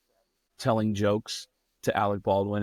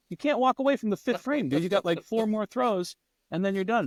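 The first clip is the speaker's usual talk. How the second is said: high-pitched retold pleading; exasperated protest; 11 semitones higher than usual. Telling him he can't leave mid-game, exasperated. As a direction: argue it out, pitched well up.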